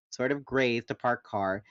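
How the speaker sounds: usual speaking voice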